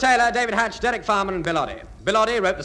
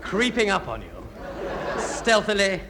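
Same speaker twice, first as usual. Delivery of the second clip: spooky storyteller. Acted. Spooky closing narration.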